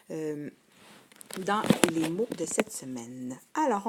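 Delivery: ordinary talk, nothing marked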